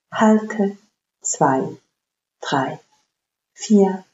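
slow, paced breath counting. Acted. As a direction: count each breath calmly and evenly. Counts the box breathing aloud.

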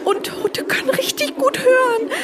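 high voice breaking into nervous laughs; scared laughing. Acted. Warning her friend that dogs hear well, scared laughter. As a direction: laugh through the fear. Hiding from dead animals.

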